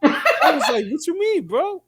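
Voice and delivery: high, laughing retold quote; laughing incredulous quote; laughing mock outrage. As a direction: tell it while cracking up. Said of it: "He actually got mad at me".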